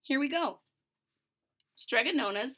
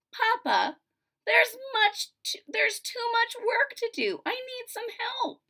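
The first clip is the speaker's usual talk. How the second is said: put-on much higher girl's plea; pleading daughter; 12 semitones higher than usual. Daughter pleading with her father, high voice. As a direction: beg papa for help.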